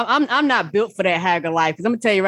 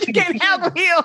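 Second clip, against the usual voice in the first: laughing echo; 7 semitones higher than usual. Real, not acted.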